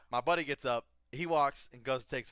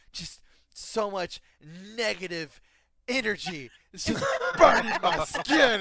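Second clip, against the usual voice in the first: slow, halting, high mock agony building louder; melodramatic sufferer; 10 semitones higher, 12 dB louder, slower than usual. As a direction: draw out each phrase, then wail. Imitating the melodramatic lady suffering.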